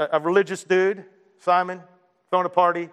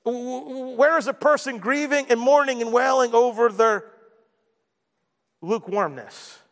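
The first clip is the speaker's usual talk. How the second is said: raised, rising pulpit cry; impassioned preacher, pitched up and pushing; preaching at full force; 6 semitones higher than usual. Acted. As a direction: pile on the questions, voice climbing high.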